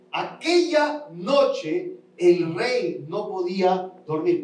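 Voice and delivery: stretched, slow reread; slow emphatic reread. Shown as a demonstration. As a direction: drag each word out to make it sink in. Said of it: "Quiero leértelo para explicarlo".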